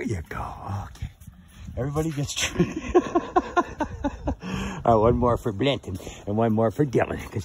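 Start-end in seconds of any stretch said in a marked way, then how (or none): 2.8-4.8 long high falling giggle. Real, not acted. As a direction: let a high giggle tumble down. Laughing at horses.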